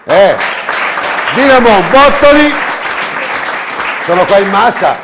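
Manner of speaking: loud, high, booming presenter; showy game show host. Acted. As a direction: hype up the crowd like a TV host.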